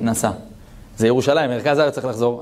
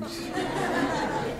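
high breathy laugh; 10 dB quieter than usual. Real, not acted. Laughing at the hotel offer.

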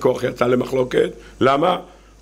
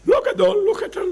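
mock picky. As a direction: jump high on לא גדול. (דרישה קטנונית בקול גבוה)

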